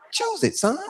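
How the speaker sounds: mock-challenge the son, squeaking high; a hammed-up high taunt at the son. Father's ultimatum.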